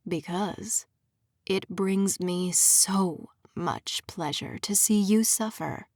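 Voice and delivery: mild husky menace; low and husky. Acted. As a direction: savour every word, low and slow. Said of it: "making his voice low and husky".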